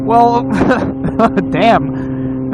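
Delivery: normal talking voice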